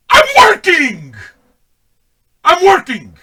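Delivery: high yelled protest, hammed-up; shouting back at wife; exasperated shout.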